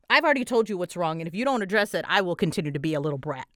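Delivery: ordinary talk, nothing marked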